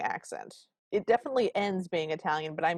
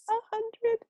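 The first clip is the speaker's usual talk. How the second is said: much higher, squeaky mock-amazed voice; mock awe. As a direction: squeak it out, pretending to be dazzled by the number. Mock awe at hundred doors.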